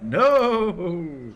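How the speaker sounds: long, loud, high mock-dismayed wail; drawn-out cry. Game master lamenting that everyone was targeted, drawn-out mock cry. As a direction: draw it out in playful despair.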